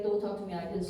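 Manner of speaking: low, soft, cold warning; firm and quiet. Retold or imitated. She calmly puts the rude vendor in his place. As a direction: say it quietly, drawing the line. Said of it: "this is the moment I need to be".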